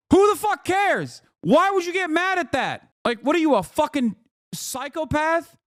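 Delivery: high-pitched incredulous outburst; exasperated rant. Real, not acted. Telling complainers the art is not for them, high exasperated outburst. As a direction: let exasperation push the voice way up.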